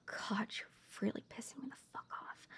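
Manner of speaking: hissed under the breath after a sigh; seething whisper, fast, to herself; furious muttered aside, no voice at all; angry whisper. Acted.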